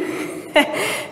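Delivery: short unvoiced laugh; a quick airy chuckle; breathy laugh. Real, not acted. Laughs at own filming struggle.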